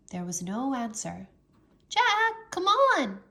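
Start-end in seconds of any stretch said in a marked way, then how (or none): 1.9-3.2 high, loud call from above; girl calling down loudly. A narrator's reading. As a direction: call him up the ladder. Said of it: "Annie called down".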